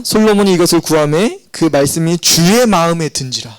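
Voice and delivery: measured, formal Bible reading; scripture reading. Acted. Pastor reading verse ten to the congregation, formal reading voice. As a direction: read the verse out with weight.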